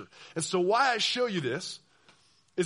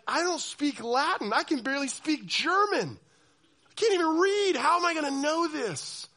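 much higher, pleading whine; frustrated peasant; 9 semitones higher, 4 dB louder than usual. Retold or imitated. Medieval peasant protesting Latin mass, high whiny. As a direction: complain helplessly to the priest. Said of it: "And you're like".

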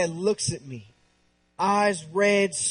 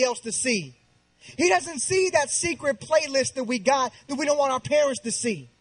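emphatic, higher-pitched sermon delivery; impassioned preaching; 5 semitones higher than usual. Acted. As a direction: preach it with driving conviction. Preaches at full force.

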